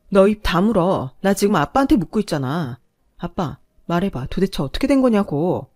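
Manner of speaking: loud, furious demand at her husband; angry shout. Acted. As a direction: snap at him to shut up. 딸이 남편에게 소리치며 따짐. (남편에게 화내며 소리치기)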